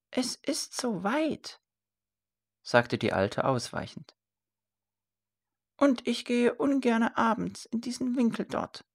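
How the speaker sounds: put-on high, hesitant old mother's voice; evasive old woman. A narrator's reading. Old mother avoiding her son's request, high and evasive.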